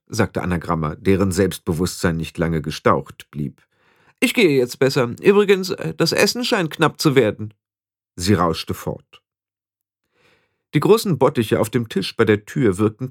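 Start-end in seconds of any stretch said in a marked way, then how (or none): 4.2-7.5 put-on high-pitched, self-assured young witch; conceited young witch, eager. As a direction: chatter on in a high, vain voice. (eingebildete junge Hexe, hohe Stimme)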